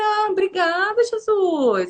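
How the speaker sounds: high, drawn-out grateful exclamation; relieved thanks. Retold or imitated. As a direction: sigh out thanks as it all makes sense. Agradece aliviada ao entender o propósito. (agradecimento aliviado, agudo e arrastado)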